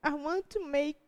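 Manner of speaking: high, tearful prayer breaking through; crying. Real, not acted. Speaker to the youth group, choked up with tears mid-prayer. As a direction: pray to Jesus through rising tears. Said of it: "why am I crying?".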